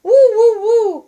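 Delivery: high, loud dog howl; puppy crying woo woo. A narrator's reading. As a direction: howl like a lonely little dog. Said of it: "cried the little dog".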